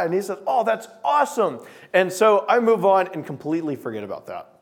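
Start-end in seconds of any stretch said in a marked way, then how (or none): 0.4-1.6 mock delighted friend. Dorm friend thrilled by offer.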